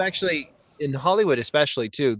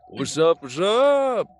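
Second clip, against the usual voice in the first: ordinary delivery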